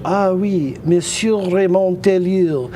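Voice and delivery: put-on slow French accent for the hotel clerk; hotel clerk greets him in a French accent. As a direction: welcome him the French way, slowly. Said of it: "he said".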